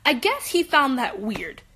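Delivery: ordinary talk, nothing marked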